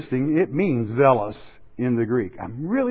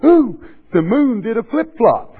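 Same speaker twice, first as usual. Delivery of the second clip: higher, louder mock gasp of wonder; mock awestruck gasp; 9 semitones higher, 6 dB louder than usual. Preacher mocking gullible folk, gasping in hammed-up awe. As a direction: ham up a gullible believer gasping at the sky.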